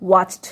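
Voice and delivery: वॉच्ड pronounced "wacht", voiceless ending; final D as T. Shown as a demonstration. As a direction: finish वॉच्ड with ch plus t. (वॉच्ड को वॉच्ट बोलकर)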